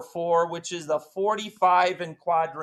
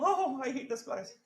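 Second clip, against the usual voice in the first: higher, softer voice of a sulky student; mock new girl; 8 semitones higher, 10 dB quieter than usual. Teacher voicing the new girl's complaint, higher and soft. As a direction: play the bored new girl. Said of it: "So new girl".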